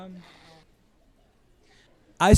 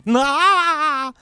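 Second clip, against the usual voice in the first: scoffing nah; 10 semitones higher, 6 dB louder than usual. Acted. Ex's scornful retort, acted.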